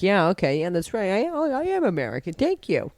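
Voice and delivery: lightly acted, rising acceptance; pretend proud American. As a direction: play along as a flattered American. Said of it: "you would be like".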